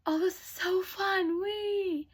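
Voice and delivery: high, delighted swing cry; gleeful squeal. Acted. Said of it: "And Cinderella goes".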